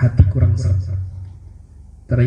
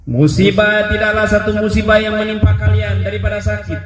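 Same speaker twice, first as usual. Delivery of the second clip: raised preaching; 10 semitones higher than usual. Acted. Preaching explanation over music.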